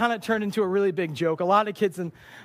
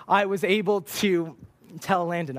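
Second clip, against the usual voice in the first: usual speaking voice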